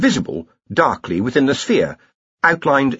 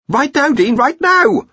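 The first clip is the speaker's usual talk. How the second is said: higher and louder, rising to an urgent cry; urgent shout; 5 dB louder than usual. Acted. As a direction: yell at the Dean to get his hand out now. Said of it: "the urgency in Ponder's voice".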